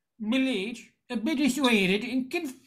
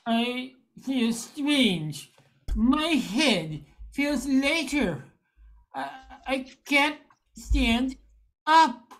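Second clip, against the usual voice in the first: dazed and slow; slower than usual. Acted. Man losing his brain cells.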